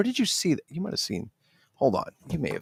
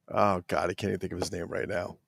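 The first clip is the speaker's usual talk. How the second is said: usual speaking voice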